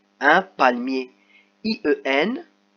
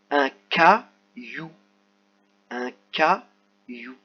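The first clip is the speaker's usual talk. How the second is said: caillou said slowly, ka-you drawn out; slow careful caillou; slow model reading; much slower than usual. Shown as a demonstration. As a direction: read un caillou slowly, letting the you ring. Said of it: "teacher models word twice".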